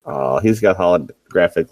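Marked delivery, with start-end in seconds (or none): none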